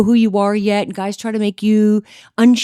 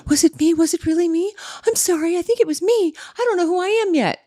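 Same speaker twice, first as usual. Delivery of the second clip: much higher, apologetic voice; imitated self-doubting girl; 10 semitones higher than usual. Imitating a girl who was told it's her fault, high and apologetic. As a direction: play a girl taking all the blame. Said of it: "and tell the girl it's her fault, and she'd be like".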